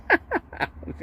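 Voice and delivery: breathy high-pitched giggle; high laugh. Real, not acted. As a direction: break into a squeaky laugh. Bursts out laughing at own joke.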